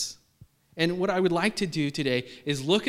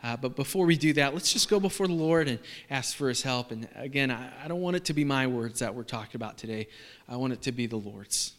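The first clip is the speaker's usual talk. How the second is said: ordinary delivery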